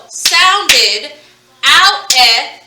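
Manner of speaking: sounded, then its vowels "out, eh" loud and separate. Shown as a demonstration. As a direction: pull the two vowels out of sounded. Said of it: "two vowel sounds".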